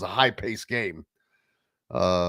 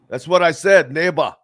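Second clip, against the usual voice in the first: ordinary delivery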